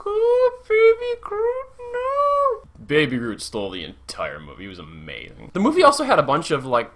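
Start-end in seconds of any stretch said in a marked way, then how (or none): 0.1-2.6 very high, drawn-out mock whimper; tearful cooing. Cooing over pitiful soggy Baby Groot, mock-crying squeal. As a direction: whine it in teary, squeaky baby talk.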